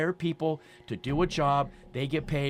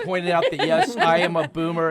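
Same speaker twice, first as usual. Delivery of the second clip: laughing; 3 semitones higher, 7 dB louder than usual. Real, not acted. Laughing at own age joke.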